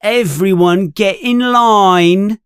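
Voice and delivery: high, carrying schoolboy yell; Malfoy yelling. A narrator's reading. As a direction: call out mockingly to a crowd. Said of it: "Malfoy yells".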